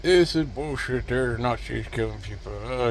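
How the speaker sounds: ordinary talk, nothing marked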